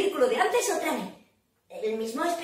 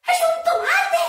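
very high, loud amazement; astonished shriek; 6 dB louder than usual. Acted. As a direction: squeal the discovery in shock. Astonished at the reveal.

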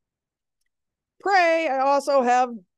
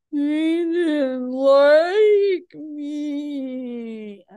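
high, drawn-out sulky whimper; sulking dog voice; 6 semitones higher, much slower than usual. Retold or imitated. Teacher voicing her dog sulking back after the deer fled, high and very slow. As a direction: stretch it out like a heartbroken puppy.

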